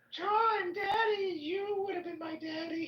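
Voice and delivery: put-on very high, wailing child voices; unborn children. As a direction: cry out for daddy in a squeaky put-on voice. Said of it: "they're all gonna be like".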